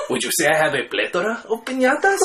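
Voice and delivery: put-on cheesy movie-bandit Mexican accent; El Guapo. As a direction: do a bad Mexican accent through a laugh. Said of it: "That was just, that was just a Mexican accent".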